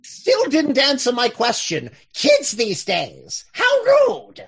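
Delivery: slow grumble then a sudden high squeak of offense; affronted fogey, pitch jumping up on how rude; mock huffy old-timer. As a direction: play the scandalized elder.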